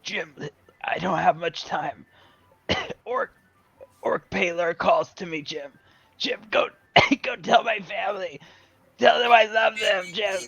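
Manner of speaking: dying friend. Pretend. Dying Dan sends love to his family, coughing and halting. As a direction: gasp out last words between coughs. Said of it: "pretending to be his dead friends".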